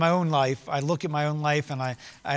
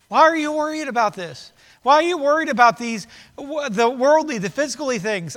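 louder, higher, insistent pleading with stressed key words; exasperated reproof; 9 semitones higher, 7 dB louder than usual. Acted. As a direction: voice Jesus urgently setting worried followers straight. Voicing Jesus reproving worried disciples.

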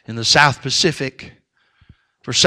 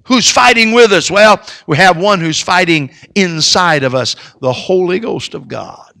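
full-force preaching; 6 semitones higher, 12 dB louder than usual. Acted. Pastor rallying fellow soldiers in church, loud high preaching. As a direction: swell up and shout it to the rafters. Preacher rises to climax again.

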